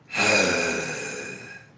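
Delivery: harsh, loud, low growl from the throat; death growl. Shown as a demonstration. Streamer demos a death growl for viewers. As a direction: push a deep metal growl out hard. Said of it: "So how you do that is".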